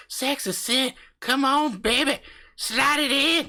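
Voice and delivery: sleazy country husband hitting on his wife; hammed-up backwoods sweet talk, half sung; crude flirting husband.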